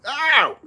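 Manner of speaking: real yelp of pain. Real, not acted. Kitten scratches him.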